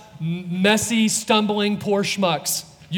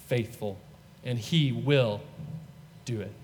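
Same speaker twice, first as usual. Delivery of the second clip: soft, low blessing that fades out; benediction; 6 semitones lower, 6 dB quieter than usual. Acted. The pastor blesses the standing congregation, soft and low.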